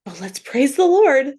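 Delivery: sing-song it as if faking joy; a hammed-up bright praise from a dark place; mock cheer. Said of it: "this heavy, dark place over to".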